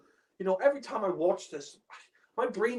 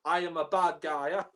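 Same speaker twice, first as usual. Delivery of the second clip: mock villain laugh; 3 dB louder than usual. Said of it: "cliche villain".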